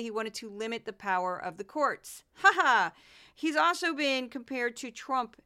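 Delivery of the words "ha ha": a short, loud mocking "ha ha"; loud sarcastic cackle; mocking laugh. Real, not acted. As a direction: scoff out a loud laugh at the absurdity.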